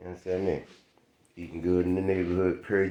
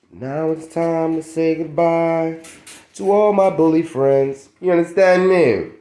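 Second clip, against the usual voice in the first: high, loud, slow outro voice; sing-song farewell to the viewers; sign-off; 10 semitones higher, 6 dB louder, slower than usual. Acted. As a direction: draw out the goodbye like a host's outro. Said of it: "signing off the vlog".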